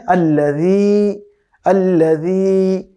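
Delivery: আল্লাযী with a soft dh, never j or z; আল্লাযী slowly, the ذ gentle; soft dhal. Shown as a demonstration. Teacher shows students the soft dhal. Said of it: "খুবই soft, নরম করে উচ্চারণ করতে হয়".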